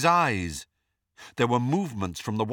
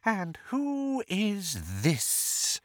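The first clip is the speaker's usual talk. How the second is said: soft snake's hiss, high and very slow; Voldemort; 8 semitones higher, 6 dB quieter, much slower than usual. A narrator's reading. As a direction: hiss it softly and slowly like a snake. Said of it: "in his soft snake's hiss".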